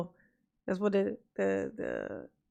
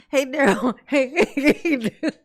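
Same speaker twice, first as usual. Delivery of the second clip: higher, louder mock calling, cracking up; laughing taunt; 5 semitones higher, 7 dB louder than usual. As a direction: heckle him through loud laughter. Taunting defendant on screen, laughing.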